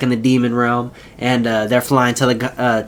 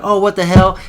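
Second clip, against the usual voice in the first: higher, louder shocked exclamation; mock surprised guards; 6 semitones higher, 4 dB louder than usual. Guards reacting to the taser, startled loud exclamation. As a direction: blurt it out, startled. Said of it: "the guards are like".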